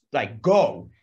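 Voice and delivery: normal talking voice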